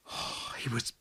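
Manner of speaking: breathless whisper of wonder; awed whisper. A narrator's reading. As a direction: whisper it, struck by beauty. Awed narrator sees Shining Man.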